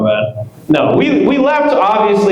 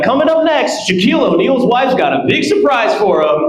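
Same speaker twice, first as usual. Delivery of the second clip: high mock TV host teaser; 6 semitones higher than usual. MTV host teasing the next segment, mock TV announcer voice. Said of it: "And then he'd be like".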